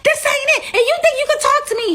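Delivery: ranting to throw them out of the house; mock shrieking rant. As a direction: girlfriend shrieking that they must leave. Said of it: "so she's saying".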